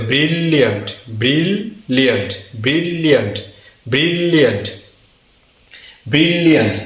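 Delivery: Brilliant leaning on a long bril; stressed, lengthened syllable. Shown as a demonstration. Teacher drilling learners, stressed and held bril-liant. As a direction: stretch bril, then release liant in brilliant. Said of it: "একটু stress দিয়ে".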